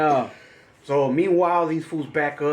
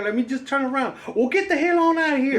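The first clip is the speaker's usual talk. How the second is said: mock furious yell; 10 semitones higher than usual. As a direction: scream it out like a grumpy old man. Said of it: "All right, like".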